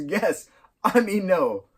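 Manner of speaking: confess, then hastily take it back; acted flustered kid. Acts out Greg blurting, correcting himself.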